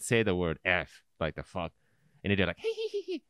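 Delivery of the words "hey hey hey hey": very high, soft tittering; mimicked kids' giggle. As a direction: giggle like naughty schoolkids. Imitating kids giggling at swearing.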